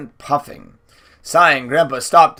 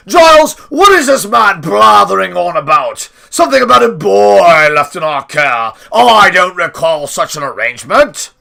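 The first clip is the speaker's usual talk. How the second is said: put-on loud, higher demanding old lord; irritated shout; 4 semitones higher, 9 dB louder than usual. A narrator's reading. Grandpa demanding answers from Giles, loud and irritated. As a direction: bark the question in annoyance.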